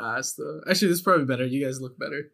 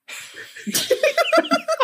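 shrieking high laugh; high squealing laugh. Real, not acted. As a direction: burst into a squeaky cackle. Laughing at "ukai.

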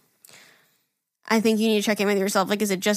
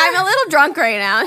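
mocking drunk girl; 6 semitones higher, 5 dB louder than usual. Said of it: "She's like".

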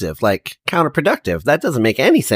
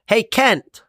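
calling out; 9 semitones higher, slower than usual. Acted. Calling a companion over.

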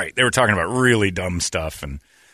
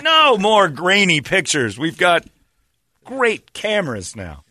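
high, slow, emphatic protest; mock exasperation; 7 semitones higher, 3 dB louder, slower than usual. Demanding better Nessie photos, exasperated high protest. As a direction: draw out each word in exasperation.

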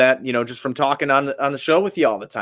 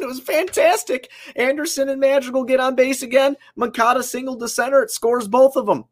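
higher, lively play-by-play recap; excited; 10 semitones higher than usual. Real, not acted. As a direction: call the runs like a hype man.

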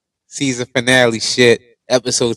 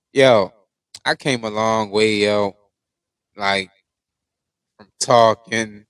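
usual speaking voice

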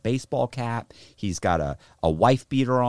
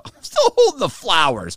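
higher, louder comic outburst; exclaimed aside; 9 semitones higher, 6 dB louder than usual. Retold or imitated. Telling friends about the gunman walking past, exclaimed aside. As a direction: blurt it out in mock exasperation. Aside amid tense retold standoff.

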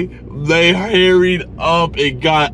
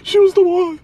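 sobbing lament; 4 dB louder than usual. Acted. Heartbroken outburst in the car.

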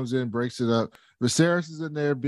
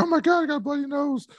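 mock whiny kid; 12 semitones higher, faster than usual. Jace voiced whining.

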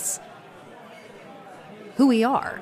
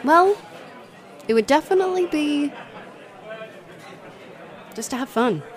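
excited; 9 semitones higher than usual. Acted. Eager answer to her idol.

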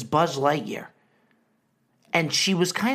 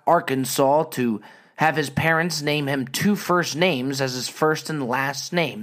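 ordinary delivery

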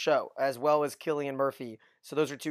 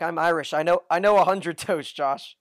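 louder, playing Grace boasting about her toasts; proud Irish retort; 2 semitones higher, 8 dB louder than usual. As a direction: toss it off as a proud loud brag. Acting out Grace's proud reply.

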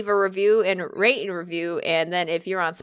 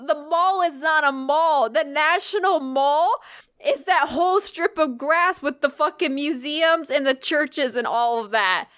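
mildly exasperated explaining, higher-pitched and drawn out; retold explaining; 6 semitones higher, slower than usual. The girl explains the National Mall to the storyteller, mock exasperated explaining. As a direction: spell it out slowly, imitating the friend.

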